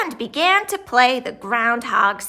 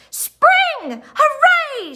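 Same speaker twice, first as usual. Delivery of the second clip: much higher, louder cheer; joyful shout; 6 dB louder, much slower than usual. A narrator's reading. Reporters celebrate the coming spring, shouting. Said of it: "shouted the reporters".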